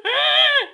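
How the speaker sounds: loud crying shriek; sudden high, loud shocked cry; panicked gasp and scream. Real, not acted. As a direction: gasp then scream as the fish falls. Fish drops onto counter.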